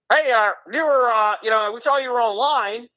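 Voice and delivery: high-pitched hammed-up Aussie caller; mock Australian accent. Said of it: "Am I doing the right Australian accent".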